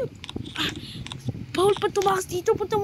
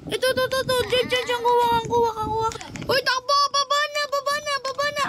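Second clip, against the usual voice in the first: higher, urgent calls mid-chase; while running; 6 semitones higher than usual. Acted. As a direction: shout directions while fleeing. Fleeing in the game.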